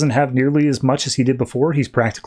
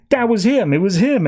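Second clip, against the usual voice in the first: high-pitched imitation of an excited kid; excited insistence; 8 semitones higher than usual. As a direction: retell it as an urgent revelation. Kid excitedly reveals Superman.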